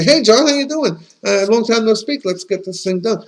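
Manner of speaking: high, breezy acted hello; chummy phone greeting. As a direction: greet an old pal on the phone, then get to business.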